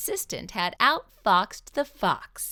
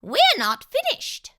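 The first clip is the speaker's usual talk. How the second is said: high mouse dentist voice; 8 dB louder than usual. Acted. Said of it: "said Doctor De Soto".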